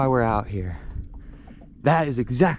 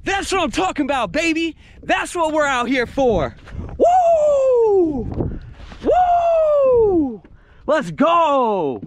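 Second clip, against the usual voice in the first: much higher, loud victory whoops; excited whooping; 4 dB louder, much slower than usual. Real, not acted. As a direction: celebrate the catch at full voice. Celebrating landing a big snook.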